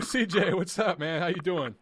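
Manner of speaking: ordinary talk, nothing marked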